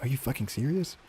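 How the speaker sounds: put-on a kid who can't believe it; mock shocked kid voice; incredulous. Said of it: "I was like".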